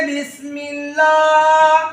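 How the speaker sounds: बिस्मिल्लाह slowly chanted, last "aa" held; recitation demo. Shown as a demonstration. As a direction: draw out बिस्मिल्लाह syllable by syllable on a level note. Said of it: "तो इसको इस तरह पढ़ेंगे". (बिस्मिल्लाह को धीरे, खींचकर पढ़ते हुए)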